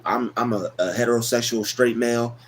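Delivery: ordinary delivery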